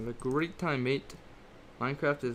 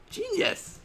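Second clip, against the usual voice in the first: mock shrill praise.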